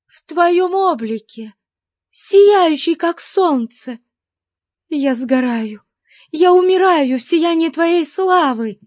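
high, ecstatic woman's voice; terrified play-acting. A narrator's reading. Captive feigns a vision before her captor, high rapturous cries. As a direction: cry out high, as if overwhelmed by divine glory. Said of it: "Ужас её положения усиливал её актёрские способности".

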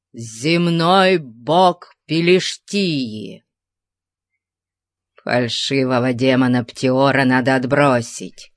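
ordinary delivery, nothing marked

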